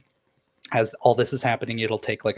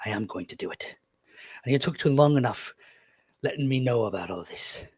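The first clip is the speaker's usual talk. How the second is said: gruff captain. Acted. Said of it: "He goes".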